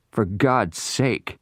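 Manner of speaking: desperate plea. Acted. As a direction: beg for mercy, drawn out. Noah begs trapped in the smoke.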